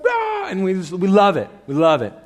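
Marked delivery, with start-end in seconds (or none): 0.0-0.5 very high imitated cheer; mock crowd yell. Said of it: "let's all yell together".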